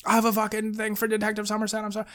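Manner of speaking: imitating panic, high and small; mock panicked apology. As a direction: squeak out the excuse as a scared courier. Acting out panicked delivery driver.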